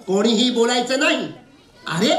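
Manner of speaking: usual speaking voice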